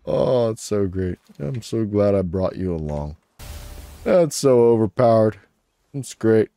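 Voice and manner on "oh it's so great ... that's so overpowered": ordinary delivery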